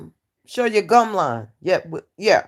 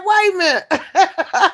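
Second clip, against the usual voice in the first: mock protest; 11 semitones higher, 4 dB louder, slower than usual. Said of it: "I said".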